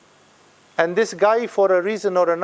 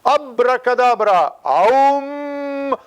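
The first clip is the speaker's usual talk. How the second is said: higher, chanted spell with a held hum; mock magician's incantation; 7 semitones higher, 3 dB louder, much slower than usual. Teacher mocking what Jesus doesn't do, mock incantation. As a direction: intone a hammed-up spell, drawing out the "aum". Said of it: "Jesus doesn't do".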